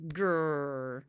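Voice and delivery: low growl in his sleep; sleepy growl. Acted. Calvin growling in his sleep, low grumble. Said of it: "said Calvin".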